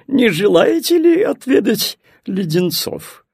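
a touch of sugary flattery; ingratiating. Acted. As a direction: coax her sweetly. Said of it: "вкрадчиво обратился он". (вкрадчиво, слащаво)

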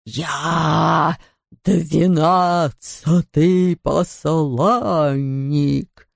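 very slow booming ghost. Acted. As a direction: drag each word out like a spectre. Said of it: "раздалось из его белого рта".

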